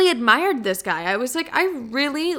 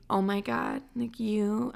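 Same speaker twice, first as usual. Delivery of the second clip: mock deep and gentle; 10 dB quieter, slower than usual. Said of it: "I'm getting all deep".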